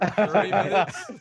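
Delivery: loud, slow, laughing. Acted. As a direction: laugh the words out. GM laughs, mimicking the players.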